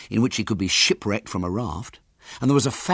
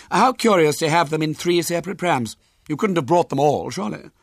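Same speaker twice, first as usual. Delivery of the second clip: high, loud, prim busybody voice; a bossy lady interrogating the boy; lifted, carrying voice of a nosy woman; officious lady; 9 semitones higher, 5 dB louder than usual. Acted. Officious woman questioning William.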